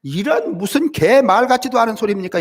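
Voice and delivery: hammed-up huff of a son denied his inheritance; imitating a spoiled son's angry protest; mock outraged outburst. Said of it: "굽던 소고기를 패대기를 치면서".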